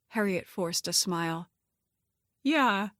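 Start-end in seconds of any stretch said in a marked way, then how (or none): none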